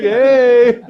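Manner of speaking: high, loud cheery holler; mock hailing shout. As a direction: call out a comic greeting. Mock friendly hail to the officer.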